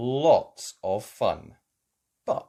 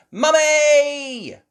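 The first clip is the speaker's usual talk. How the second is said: high, loud call; girl announcing a trip; crying out; 12 dB louder than usual. Acted. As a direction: call out gleefully. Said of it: "cried Peppa".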